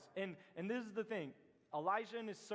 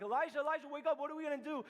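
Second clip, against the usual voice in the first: higher, louder frantic wake-up call; a scared servant calling out to Elijah; panicked servant; 7 semitones higher, 4 dB louder, faster than usual. Retold or imitated. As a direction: shake your master awake in a panic. Servant panics at surrounding army.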